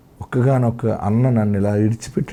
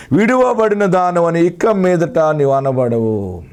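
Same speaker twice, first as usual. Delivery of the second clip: fervent proclamation; 7 semitones higher, slower than usual. Shown as a demonstration. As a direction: proclaim the verse slowly, lifting "విడువబడిన". నీతో మాట్లాడుతున్న మాట ఏంటంటే. (ఉద్వేగంగా నెమ్మదిగా ప్రకటన)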